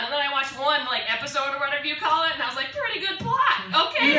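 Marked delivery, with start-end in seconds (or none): 2.7-4.2 mock impressed. Reacting to porn.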